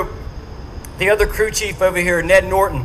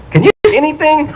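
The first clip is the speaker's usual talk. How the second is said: urgent pilot over the intercom, louder; pilot on the headset; 7 semitones higher, 6 dB louder, faster than usual. Retold or imitated. Pilot on the intercom in brownout, urgent. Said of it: "this is what I heard him saying".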